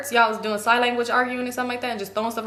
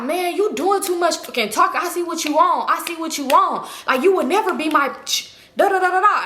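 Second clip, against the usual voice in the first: higher-pitched argumentative man's rant; acted scolding; 6 semitones higher than usual. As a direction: imitate him telling her off, then trail into nonsense syllables. Acted quarrel, man telling her off.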